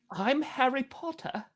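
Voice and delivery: put-on playful hushed British accent; mock British accent. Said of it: "now talk like a British person".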